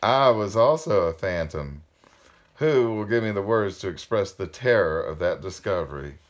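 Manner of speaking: emphatic, projected read-aloud; story narration. A narrator's reading. Reader telling of the diamond-hard flower, emphatic storytelling. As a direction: narrate with weight on the key words.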